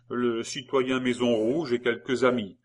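plain reading voice

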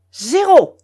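loud, high call of the number; sharp croupier announcement; croupier's cry. A narrator's reading. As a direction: call it out across the table. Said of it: "cria le croupier".

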